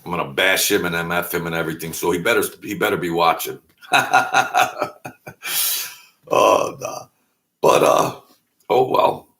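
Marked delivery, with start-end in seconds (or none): 3.9-5.9 rapid dry chuckle. Real, not acted. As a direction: laugh at your own threat. Laughing at his own threat.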